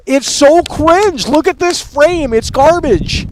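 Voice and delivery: high, loud, slow drawn out cringe; high slow mocking groan; mocking disgust. Real, not acted. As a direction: stretch it out in disgust. Mocking trailer opening.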